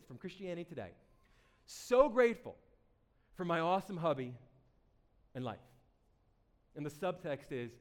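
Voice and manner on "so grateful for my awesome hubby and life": gushing mock quote. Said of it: "The title is this".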